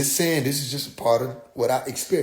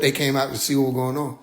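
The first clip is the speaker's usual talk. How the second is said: normal talking voice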